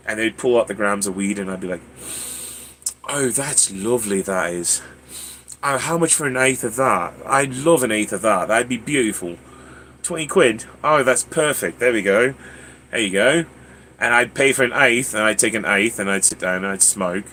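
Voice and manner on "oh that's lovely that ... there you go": chatty put-on British accent. Tourist buying an eighth in Amsterdam, British accent. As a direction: play the polite British customer. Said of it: "talk like this".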